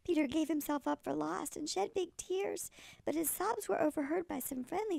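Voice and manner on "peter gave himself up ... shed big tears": ordinary delivery, nothing marked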